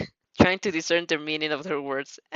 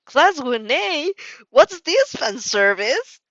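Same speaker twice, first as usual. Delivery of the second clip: slow, loud stadium announcer call; announcer shout; 7 semitones higher, 5 dB louder, much slower than usual. Acted. President Mike over the stadium speakers, slow loud announcer shout. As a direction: boom it through the stadium. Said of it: "blasts through the stadium shouting".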